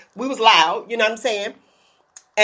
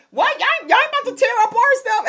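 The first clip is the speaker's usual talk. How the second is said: mock indignant local; 10 semitones higher than usual.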